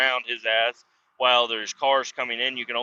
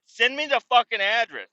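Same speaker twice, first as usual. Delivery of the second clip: high barked demand; angry yell; 9 semitones higher than usual. Retold or imitated. Demanding the address from coworkers, angry yell.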